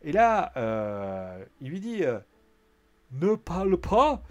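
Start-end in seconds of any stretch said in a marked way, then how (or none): 3.1-4.2 loud, high mock German accent; Ludwig Kaiser. Kaiser scolding McIntyre, mock German accent. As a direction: bellow slowly in a thick put-on German accent. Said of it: "qui a fait l'accent de Ludwig Kaiser".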